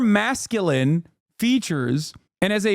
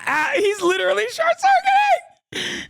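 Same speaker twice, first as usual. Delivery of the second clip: very high strained squeal of glee; mock malfunctioning squeal; 13 semitones higher than usual. Streamer mocking a short-circuiting debater, high squeal. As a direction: squeak it out like a system overloading. Gleefully mocks a flustered debater.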